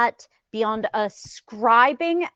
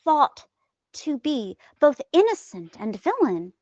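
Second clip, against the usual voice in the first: ordinary talk, nothing marked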